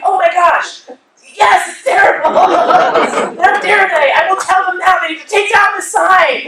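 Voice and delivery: high, loud hammed-up indignation; imitated outrage. Girlfriend mimics boyfriend vowing to confront them, loud mock outrage. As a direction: mimic him getting worked up, loud and high.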